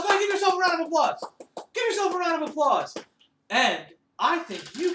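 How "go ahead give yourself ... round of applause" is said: higher, louder, fast call to clap; excited cheer. Real, not acted. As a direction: enthusiastic teacher cheering the class.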